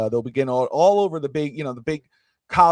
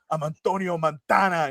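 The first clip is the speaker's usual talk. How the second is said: mock Scarface accent; 2 semitones higher than usual. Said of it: "he does his whole thing".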